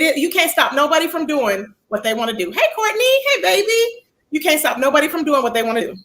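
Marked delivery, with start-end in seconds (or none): 2.5-4.1 sing-song greeting. Real, not acted. Greeting chat member.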